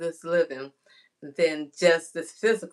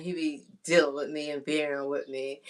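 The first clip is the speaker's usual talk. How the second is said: ordinary delivery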